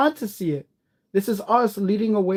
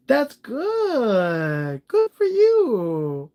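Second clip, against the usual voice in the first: usual speaking voice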